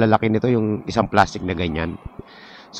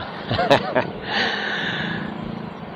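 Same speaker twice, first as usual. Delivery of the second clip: breathy chuckle; 8 dB quieter than usual. Real, not acted. Laughs at pushy motorcyclists.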